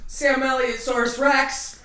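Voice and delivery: big booming announcer intro; dramatic announcer voice. Pretend. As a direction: announce it like a movie trailer. Announcing Sam Elliott dramatically.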